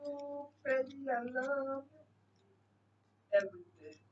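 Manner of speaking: soft, hushed, swooning coo; breathy delight. Real, not acted. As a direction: coo over it softly. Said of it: "That's what I sounded like".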